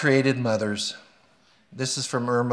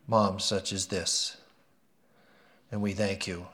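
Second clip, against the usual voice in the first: prayer; 3 semitones lower, 5 dB quieter than usual. Real, not acted. Pastor prays for the mothers in church, hushed and reverent. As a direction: pray it humbly, letting it fade to the amen.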